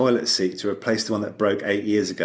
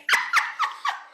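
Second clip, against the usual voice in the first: squealing laugh. Real, not acted. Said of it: "I can't".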